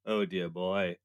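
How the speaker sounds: low, soft British thespian; Olivier. Acted. Olivier on the couch on set, low hushed thespian voice. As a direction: quietly drop into a grand old-English actor. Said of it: "vision of Olivier sitting on a couch".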